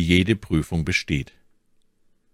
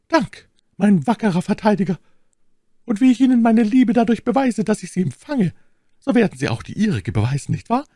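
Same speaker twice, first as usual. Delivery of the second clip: high-pitched lady's voice, coaxing; scheming lady, coaxing; 5 dB louder than usual. A narrator's reading. My Lady thanks her new defender, coaxing. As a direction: win him over in a high, sweet-talking voice.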